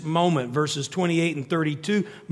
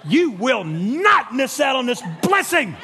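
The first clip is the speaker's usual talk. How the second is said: mock shout; 12 semitones higher than usual. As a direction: bark it like a slap. Wife's slap and command retold.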